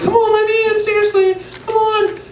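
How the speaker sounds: very high pleading voice; whiny mock pleading. Locked-out sister begging brother, high whiny plea. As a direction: beg in a high, exasperated whine.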